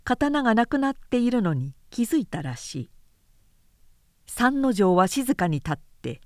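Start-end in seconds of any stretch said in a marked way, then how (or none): none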